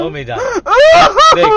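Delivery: choking up; tearful plea. Real, not acted. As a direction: beg him through tears. Comforting, voice breaking.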